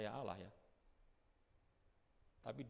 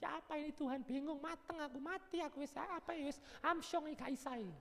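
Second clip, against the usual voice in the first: panicky believer. Pretend. Worried believer fretting to himself, high and whiny. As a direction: play a worried churchgoer losing heart.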